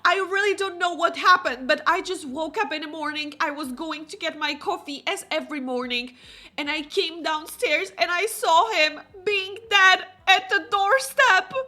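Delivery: high, loud, hammed-up tearful account; distraught mother. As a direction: pour out the shock as a melodramatic mom. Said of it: "Elsa's mom is like".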